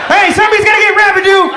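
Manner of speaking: high, loud, fast call for help; acted battlefield shout. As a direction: yell out for someone to help. Acts yelling out in combat.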